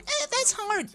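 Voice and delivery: high, whining mock of a crybaby player; whiny mock. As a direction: whine it like a quitter in a silly high voice. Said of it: "stop being like".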